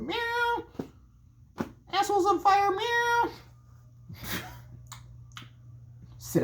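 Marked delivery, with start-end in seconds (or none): 0.0-3.3 high, far-off mewing cat; squeaky cat mocking a burning owner. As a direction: sneer it as a smug cat. Voicing the indifferent cat.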